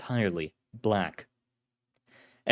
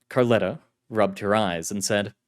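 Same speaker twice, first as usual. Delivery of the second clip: usual speaking voice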